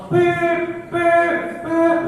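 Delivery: high beeping horn sound; high mock car horn; horn imitation. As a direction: honk like an impatient car.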